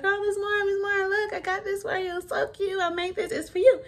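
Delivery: put-on high, bubbly child voice, eager to show off her gift; little girl's voice, bursting with excitement; excited little girl. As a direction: play the excited student handing over a drawing. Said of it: "And she's like".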